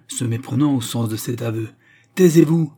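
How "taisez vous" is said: very loud, high shouted command; repeated order. A narrator's reading. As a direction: shout insistently for silence. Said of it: "réitéra le greffier". (sommation criée, insistante)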